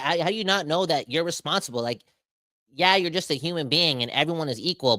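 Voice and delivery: usual speaking voice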